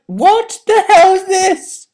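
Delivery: slow, high, loud disbelief; baffled. Retold or imitated. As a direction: draw it out, stunned. Reacting to bizarre film.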